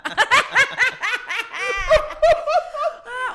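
loud, high burst of laughter; big laugh. Real, not acted. After her own awkward small-talk lines, a loud high laugh. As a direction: crack up out loud.

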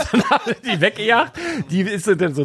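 high, giggling narration; laughing while telling. Real, not acted. Recounting the presenter leaving, still laughing. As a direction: keep laughing through it. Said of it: "ich musste so lachen".

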